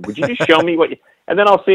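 exasperated plea, pitched high; retold pestering, half-threat and half-begging; mock threatening. Dad arguing to see his son's pictures, mock threatening. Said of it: "I have to kinda threaten him".

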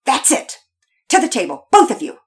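loud, stern fatherly order; out of patience. A narrator's reading. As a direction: order the children off sharply. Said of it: "Daddy Peters' voice was less than patient".

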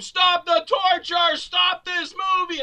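exaggerated crowd yelling at a screen; mock screaming crowd. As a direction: howl it like a tortured audience. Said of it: "people were screaming".

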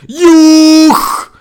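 huge high shriek; sparrow's yell. A narrator's reading. Sparrow terrifies the owl and crow, screaming at full force. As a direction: scream it with all your might. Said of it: "продолжал страшно орать".

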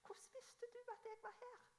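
put-on a frail, puzzled old mother asking; imitating confused mother. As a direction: son imitating his forgetful mother's puzzled question, high and tearful. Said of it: "sa hun alltid".

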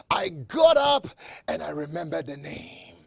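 loud, ringing pulpit proclamation; preaching at full force. Acted. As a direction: preach it out to the whole church.

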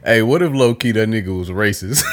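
ordinary talk, nothing marked

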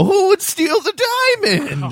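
very high, slow mock cry; hammy fake outrage. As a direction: wail it like a bad actor. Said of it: "stand in front of the store and go".

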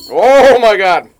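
slow, loud drawn-out oh my God; loud groan. Real, not acted. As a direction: stretch it out in pain. Reacting to hot sauce.

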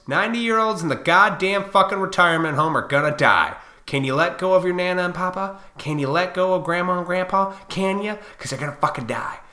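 usual speaking voice

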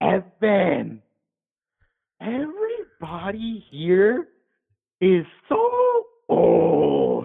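put-on very high, wailing drunk stage whisper gone wrong; imitating loud drunk patient. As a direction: stretch each word out in a high drunk whine. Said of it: "but she's being real loud".

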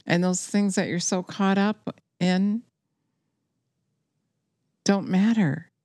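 ordinary talk

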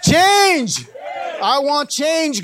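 loud high shout of change; preacher's shouted change; shouted response. Real, not acted. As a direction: call it out with the congregation. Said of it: "somebody say".

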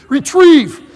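loud, high shout at the dog; yelled command. Retold or imitated. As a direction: yell it as an order to your dog. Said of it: "he wouldn't retrieve it till I yelled it".

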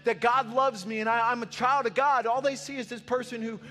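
put-on whiny teenager voice. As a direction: play a hurt, overlooked teenager. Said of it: "Or maybe if the youth in this room, it's like".

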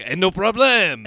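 mock French skunk; a hint of a French accent. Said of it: "the skunk has a French accent".

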